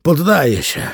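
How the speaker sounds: high, loud winded surrender; out of breath, acted. A narrator's reading. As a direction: give up as if winded, voice pushed high. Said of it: "zdyszany ledwie mógł mówić". (udawana zadyszka, wysoki głos)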